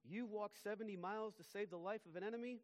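as if he can't believe his ears; incredulous. A narrator's reading. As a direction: Washington astonished at the preacher's deed. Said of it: "cried Washington".

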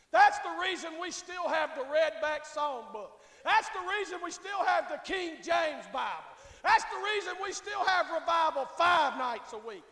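loud, driving sermon refrain; full-force preaching. Real, not acted. Preacher rallying the church to keep the old ways, full-force preaching. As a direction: hammer each reason home, building in volume.

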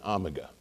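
"omega" said AH-muh-ga; "omega" with a short AH first; third pronunciation. Shown as a demonstration. Pastor ends the list with AH-muh-ga. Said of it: "three ways you can pronounce it".

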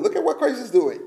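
ordinary talk, nothing marked

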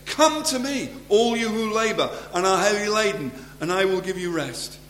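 high, ringing scripture quotation; proclaimed invitation. Acted. Preacher quotes Jesus's promise to the weary, proclaimed invitation. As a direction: call it out high, easing down toward the end.

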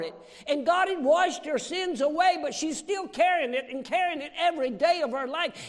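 loud, high, building sermon voice; full-force preaching. Acted. Preacher building to his congregation, loud and high.

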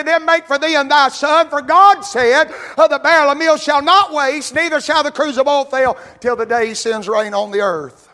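high, loud preacher's cry, voicing Elijah; full-force preaching. Preaching Elijah's words aloud.

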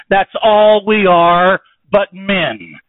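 slow, loud, high pulpit emphasis; preacher hammering home the point; slow emphatic preaching. Real, not acted. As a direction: lean on each word to land the point. Preacher's climax line.

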